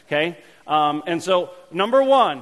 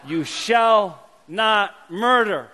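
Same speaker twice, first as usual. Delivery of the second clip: You shall not murder, each word drawn out slowly; slow and solemn; 3 semitones higher, 4 dB louder, much slower than usual. Shown as a demonstration. Pastor teaching the congregation the commandment, slow and solemn. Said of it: "teaching the commandment slowly".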